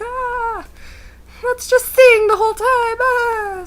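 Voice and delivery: high, loud crying wail; mock wailing. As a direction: wail over the loud music. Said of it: "and I'm like".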